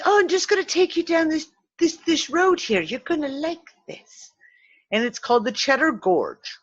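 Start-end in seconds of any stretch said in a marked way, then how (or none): 0.1-4.3 high-pitched mock-innocent coaxing; practical joker. Joker friend luring passengers down the road, mock-innocent high voice. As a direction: imitate the joker luring them along. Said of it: "who's a practical joker".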